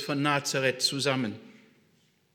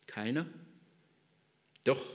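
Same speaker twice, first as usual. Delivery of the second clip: ordinary talk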